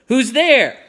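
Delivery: shouted. A narrator's reading. Captain demanding who is hiding, loud shout. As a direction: shout it out sharply at intruders. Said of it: "the captain shouted again".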